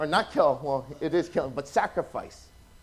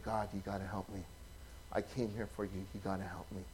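much softer and lower, a desperate plea; hushed desperate prayer; 11 semitones lower, 16 dB quieter than usual. Retold or imitated. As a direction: pray under your breath while hiding. Hiding, exhausted, praying in cave.

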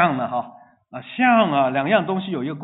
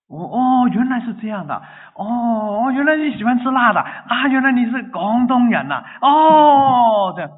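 high, loud delighted "oh, so that's it"; surprised discoveries; 9 semitones higher, 8 dB louder than usual. Pretend. Preacher playing a new friend, finding out about them, delighted and surprised. Acting out getting to know a friend.

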